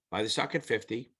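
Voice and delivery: ordinary talk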